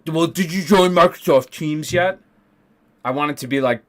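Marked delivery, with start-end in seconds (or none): 0.1-2.2 higher, louder snide bureaucrat voice; mock assistant director. Teacher mocking the assistant director's useless reply, snide mimicry. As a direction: parrot her back in a snotty higher voice. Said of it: "And she's like".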